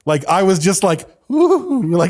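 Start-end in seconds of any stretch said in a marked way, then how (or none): 1.3-1.8 swooning ooh. Retold or imitated. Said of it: "I can't even explain it".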